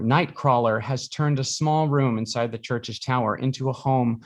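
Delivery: ordinary delivery, nothing marked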